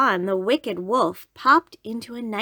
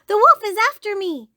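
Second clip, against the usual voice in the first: high, loud cry for help; little girl crying out, pitch jumping way up; alarmed cry; 13 semitones higher, 8 dB louder than usual. A narrator's reading.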